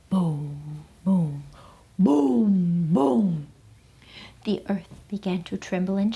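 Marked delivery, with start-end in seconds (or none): none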